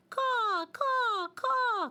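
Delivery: high loud cawing; crow call. Acted. Crow caws as the dreidel passes. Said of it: "called the crow".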